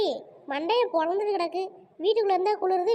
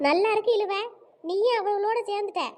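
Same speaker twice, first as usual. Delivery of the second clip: usual speaking voice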